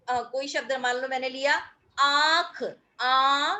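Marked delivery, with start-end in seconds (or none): 2.0-3.6 "आंख" then "आ" with the aa nasalized through the nose; nasal vowel demo. Shown as a demonstration. Teacher shows the nasalized vowel in "आंख". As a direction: say "आ" pushing sound through throat and nose. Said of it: "मैंने गले से भी जोर लगाया आ".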